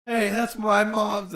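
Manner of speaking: high, slow put-on Jay voice; slow, high mock Jay quote; imitating Jay. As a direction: ham up the stoner line, drawn out. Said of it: "what's the fucking thing he says".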